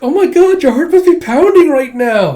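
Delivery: very high and loud; excited squeal. Retold or imitated. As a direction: squeal it teasingly. Becky teasing excitedly.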